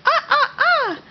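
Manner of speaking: high, laughing monkey ah ah ah; monkey call. Shown as a demonstration. Mother copying her toddler's monkey sound, high and laughing. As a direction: hoot it up high like a playful monkey. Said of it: "How about a monkey?".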